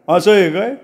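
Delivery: Raghu sneering. A narrator's reading.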